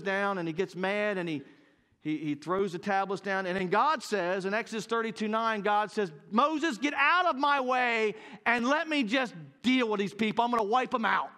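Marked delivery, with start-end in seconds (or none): 6.3-11.3 booming mock-divine fury; wrathful God. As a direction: thunder it out as God losing patience. Acting out God's wrath at Israel.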